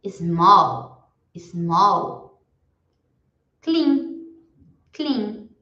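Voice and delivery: each word said slowly and clearly, then repeated; vocabulary drill for students to repeat; repeat-after-me drill. Shown as a demonstration. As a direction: model every word for the class to echo. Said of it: "Eu vou falar e vocês repetem em casa".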